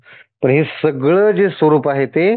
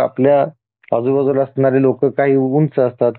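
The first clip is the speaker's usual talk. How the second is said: normal talking voice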